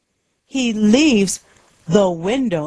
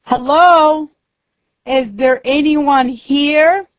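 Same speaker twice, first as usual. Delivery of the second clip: high, raised-voice call; shouting; 8 semitones higher, 4 dB louder than usual. A narrator's reading. Akira hears a noise and calls out, shouting. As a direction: call out hopefully to whoever is there. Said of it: "He shouts".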